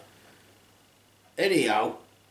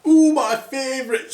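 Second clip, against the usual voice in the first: high delighted coo; hammed-up high excited dwarf; happy dwarf; 10 semitones higher, 3 dB louder than usual. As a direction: squeal it up high with glee. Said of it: "said Happy".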